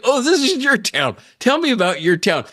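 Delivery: sarcastic taunt at the stranger; mocking sarcasm. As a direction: throw his words back at him. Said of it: "I was so livid".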